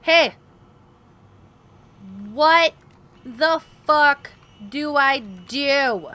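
loud furious outburst slowing at the end; angry shout. Real, not acted. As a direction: yell at the game. Stuck, yelling at game.

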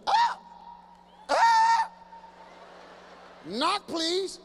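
very high, loud startled shriek, then a high demand; shrieking teen. Retold or imitated. Teenager screaming when a parent enters without knocking. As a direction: scream like you've been walked in on.

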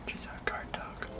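hushed whisper. Real, not acted. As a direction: whisper it near the dog. Whispering near guarding mother.

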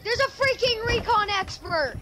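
high, thrilled squeal; excited squeal. Real, not acted. Spots rare skin, squeals.

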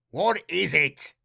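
snapped. A narrator's reading. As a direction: snap it with impatience. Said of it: "Aesop snapped".

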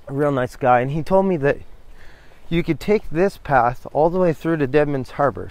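ordinary talk

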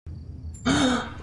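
sharp gasp at a gunshot; frightened intake of breath; shocked gasp. Real, not acted. Gunshot scene.

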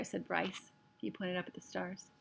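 usual speaking voice

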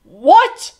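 very loud, outraged royal yell; bellowed. A narrator's reading. The king is told off by a boy, bellowing. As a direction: bellow it in disbelief. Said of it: "he bellowed".

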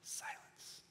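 breathed almost to nothing; hushed. Shown as a demonstration. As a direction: whisper the word silence. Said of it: "divine silence".

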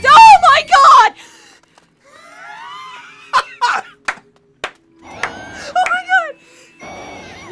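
screaming and laughing in fright; terrified scream. Real, not acted. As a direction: shriek then laugh it off. Jump scare in horror game.

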